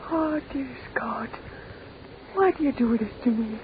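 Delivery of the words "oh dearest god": high, soft, tearful whisper; breaking, hushed cry of grief; anguished prayer. Acted. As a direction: whisper to God through tears. Mother prays in anguish at the voice.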